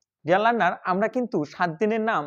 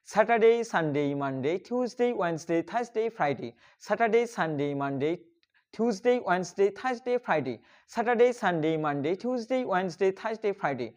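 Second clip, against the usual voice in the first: days chained together, dropping softer after each start; repeated practice drill; 3 dB quieter than usual. Shown as a demonstration. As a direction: recite the week over and over, barely pausing. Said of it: "বারবার এভাবে সুন্দরভাবে practice করবেন". (বারবার টানা অনুশীলনের সুরে)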